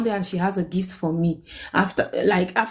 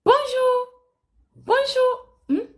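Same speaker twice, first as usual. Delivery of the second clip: fake smiling greeting; slower than usual. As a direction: greet her with a forced fake smile. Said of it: "You know that fake smile".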